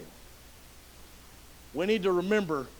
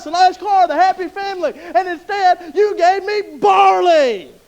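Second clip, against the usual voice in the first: high, loud demanding prayer, slowing at the end; loud complaint to God; 11 semitones higher, 12 dB louder than usual. Acted. Believer shouts a complaint to God, high and loud. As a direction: shout your wants at heaven, drag out the last words.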